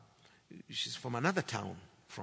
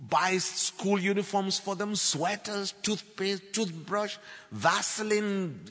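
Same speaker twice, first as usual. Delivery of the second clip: loud, emphatic listing, building in pitch; impassioned preaching; 3 semitones higher, 6 dB louder than usual. Real, not acted. Preacher telling congregation of wife's works, impassioned. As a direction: hammer out each item to the congregation.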